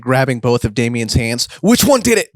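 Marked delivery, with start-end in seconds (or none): 1.6-2.3 high, urgent interrogation; frantic demand. Acted. Best grabs Damien's hands, frantically demanding. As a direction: demand an answer, pitched way up.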